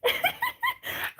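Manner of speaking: sudden high squealing laugh; burst of laughter. Real, not acted. Reading a surprising chat comment, bursts into high laughter. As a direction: caught off guard, laugh out high.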